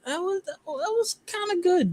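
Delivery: high-pitched, tentative approval; mock surprise, pitched right up; pleasantly surprised. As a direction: recount a surprised reaction way up high. Retold pleasantly surprised reaction.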